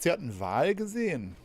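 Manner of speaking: normal talking voice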